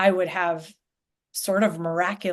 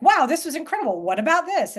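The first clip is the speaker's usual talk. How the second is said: high, eager imitated wow; mock delight; 9 semitones higher than usual. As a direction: play a diner thrilled by a new dish. Imagined eager reaction to new food.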